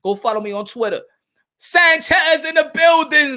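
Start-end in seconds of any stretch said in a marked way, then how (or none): 1.6-3.4 slow, louder and higher call-out; hype-man welcome shout; hype announcement. Real, not acted. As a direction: announce his arrival. Greeting a late chat member.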